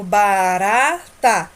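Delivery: "barata" drawn out slowly with a soft tapped R. Shown as a demonstration. Teacher showing children the soft R in "barata", drawn-out demonstration. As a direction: stretch "barata", keeping the R weak. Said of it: "é um R brando".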